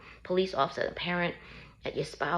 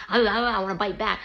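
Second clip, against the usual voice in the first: higher, louder stammered protest; acted frustration; 4 semitones higher, 6 dB louder than usual. Arrested person wanting to bite back at police, acted frustration. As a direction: burst out with pent-up mock frustration.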